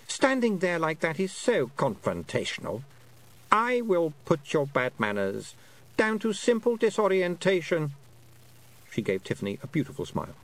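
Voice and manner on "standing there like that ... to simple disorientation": high, measured regal voice; condescending Queen. A narrator's reading. Queen putting down the girl's bad manners, high condescending voice. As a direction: excuse her as a superior queen, pitched up.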